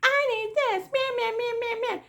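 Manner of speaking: high-pitched mocking whine, nagging nonstop; whiny family member. Nurse mocks demanding family members at the bedside, high whiny mimicry. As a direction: mimic a complainer in a high, bleating voice. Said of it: "some family members that's just like".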